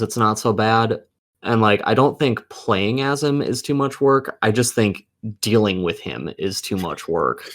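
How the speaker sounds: ordinary delivery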